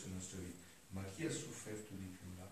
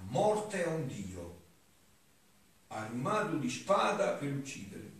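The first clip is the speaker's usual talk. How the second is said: raised, loud declaiming voice; higher-pitched oratorical reading aloud; solemn devotional reading; 5 semitones higher, 9 dB louder than usual. Acted. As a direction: declaim the sacred text with fervour. Reads devotional text aloud.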